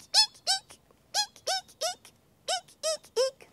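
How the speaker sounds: very high squeals; squealing eek. Acted. People in the house squealing eek, very high.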